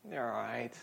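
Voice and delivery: soft, low, dismissive reassurance; mild, unworried murmur of a parent; unbothered parent. Acted. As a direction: brush off a child's whine quietly. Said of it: "when there's a whine".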